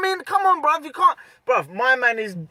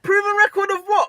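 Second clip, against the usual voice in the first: pitched way up, disbelieving; incredulous; 11 semitones higher than usual. Real, not acted. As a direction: scoff at the claim. Incredulous retort.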